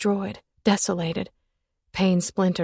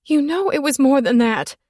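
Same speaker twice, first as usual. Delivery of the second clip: high, loud desperate plea; wheezed plea; 7 semitones higher, 6 dB louder than usual. Acted. Woman pleading, higher and louder. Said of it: "I wheezed through the desperate plea".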